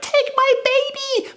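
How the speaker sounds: very high, stretched squeal; frantic fan. Retold or imitated. Lady holding baby against the glass, high mock-frantic plea. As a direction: squeal it like a desperate female fan. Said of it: "this lady saw us".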